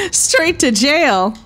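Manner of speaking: high, slow, drawn-out pronouncement; mock-outraged verdict. Player sentencing the opponent, mock-outraged.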